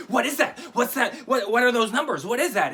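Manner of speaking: a jealous wife's mock interrogation; mock angry wife. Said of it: "she just gets so mad".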